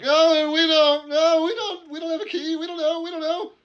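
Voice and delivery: very high, loud, frightened squeal; terrified prisoners babbling no key; squeaky panic. Acted. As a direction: squeak out the denial in terror.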